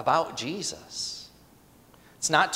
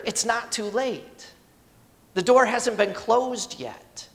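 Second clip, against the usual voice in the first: reassuring promise; 6 semitones higher than usual. Retold or imitated. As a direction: tell them kindly it is not too late. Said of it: "he's saying".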